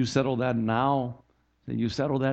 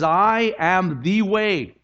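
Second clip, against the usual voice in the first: "I am the way" drawn out very slowly, each word stressed; emphatic declaration; 7 semitones higher, 4 dB louder, much slower than usual. Retold or imitated. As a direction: stretch "I am the way" into a high, weighty proclamation. Quotes Jesus's declaration emphatically.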